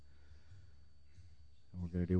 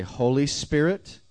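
higher, louder, slow call; 7 semitones higher, 6 dB louder, slower than usual. Shown as a demonstration. Preacher showing the congregation how to call out, loud. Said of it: "out loud".

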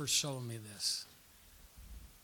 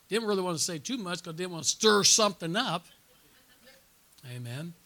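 usual speaking voice

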